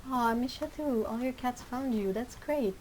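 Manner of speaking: ordinary talk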